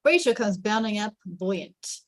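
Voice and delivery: ordinary talk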